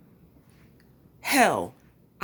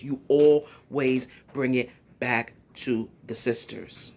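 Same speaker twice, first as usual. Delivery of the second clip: "You always bring it back" slowly, word by word; slow, emphatic repeat; 4 semitones lower, slower than usual. Real, not acted. Commentator driving the point home to Tommy, slow and emphatic.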